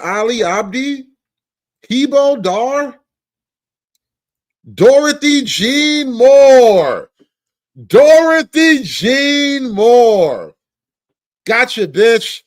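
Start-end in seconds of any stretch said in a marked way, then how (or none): 4.7-7.1 slow, loud and high, dragging every syllable; drawn-out surprised read. Acted. Dramatically reading an unexpected name. 7.9-10.5 even slower, loud and high, savouring the name; slower mock disbelief.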